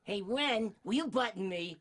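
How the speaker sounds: Stimpy asking Ren for help, Larry Fine style; amped up Larry Fine. Acted. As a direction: play it as a hyper Larry Fine. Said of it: "an amped up Larry Fine".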